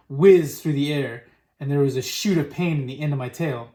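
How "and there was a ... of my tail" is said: ordinary delivery, nothing marked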